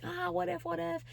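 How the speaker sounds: anxious worrying. Retold or imitated. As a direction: fret quietly, spiralling.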